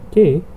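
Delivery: K said as English "kay"; K as the English letter name "kay". Shown as a demonstration. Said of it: "in English".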